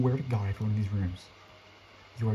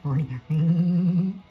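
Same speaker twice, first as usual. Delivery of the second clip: drawn out, louder mocking call; slow teasing singsong; 5 semitones higher, 4 dB louder, much slower than usual. Real, not acted. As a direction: taunt it slowly, singsong. Taunting the chasing monster.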